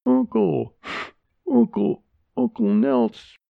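high, breathless sobbing words; crying child. Acted. Crying girl tells grandma about uncle, high and sobbing. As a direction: gasp the words through tears. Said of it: "catching my breath through my tears".

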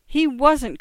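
high, loud protest; indignant cry. A narrator's reading. Niece defends the young man to her uncle, indignant cry. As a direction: leap to his defence indignantly. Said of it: "cried Angela indignantly".

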